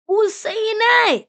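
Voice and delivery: high, loud, drawn-out question; indignant disbelief. Real, not acted. Listener reacting to the story, indignant.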